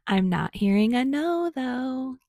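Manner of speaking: high, lilting teasing tune; sing-song. A narrator's reading. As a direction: sing it out playfully. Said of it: "Kiri sing-songed".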